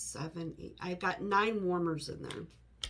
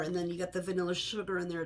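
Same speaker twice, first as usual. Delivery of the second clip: ordinary delivery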